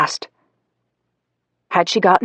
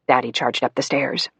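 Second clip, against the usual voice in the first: ordinary delivery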